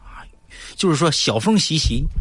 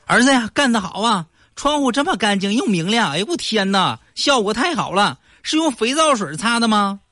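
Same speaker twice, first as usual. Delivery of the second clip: high, delighted mock praise; praising father; 9 semitones higher than usual. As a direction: gush over the clean window. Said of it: "夸他". (高声夸儿子的爸爸)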